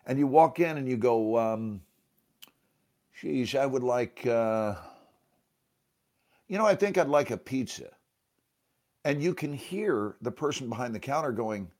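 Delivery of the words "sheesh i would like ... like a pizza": lightly done New York accent, a bit quicker at the end; a customer ordering in a mild New York accent. Shown as a demonstration. To Michelle, ordering pizza in a light New York accent. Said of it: "I want to do the accent for you".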